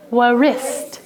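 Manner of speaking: wrist said "wa-wrist" with the W sounded as "wa"; old wa-wrist. Shown as a demonstration. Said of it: "We used to say".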